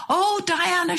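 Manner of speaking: high, excited call across the shop; calling her name from across the counter; mock shout retold. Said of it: "she shouted".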